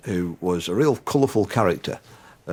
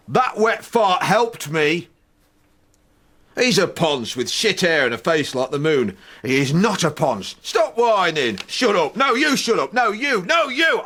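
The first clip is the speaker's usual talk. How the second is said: put-on higher and louder childish squabble; escalating row; 12 semitones higher, 4 dB louder than usual. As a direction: shout back higher each time. Escalating quarrel with anger.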